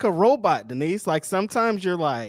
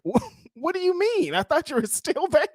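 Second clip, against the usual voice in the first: high, startled and laughing; surprised, breaking into a laugh; shocked disbelief; 9 semitones higher than usual. Retold or imitated. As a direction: act thrown off guard. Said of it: "And I'm like".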